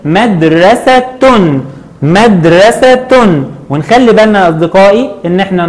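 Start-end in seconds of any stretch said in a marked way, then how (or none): 0.0-3.1 مدرسة slowly, ending in a sounded "-tun"; tanween damm ending. Shown as a demonstration. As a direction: say مدرسة as "madrasatun", syllable by syllable. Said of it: "عند التنوين بالضم هنقول".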